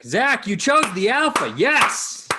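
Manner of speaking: loud, high cheer of praise; excited praise. Real, not acted. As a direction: cheer him on, voice soaring.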